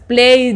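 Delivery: "played" with the d started but left unreleased. Shown as a demonstration. Teacher shows the half-finished d in "played". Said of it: "no completas".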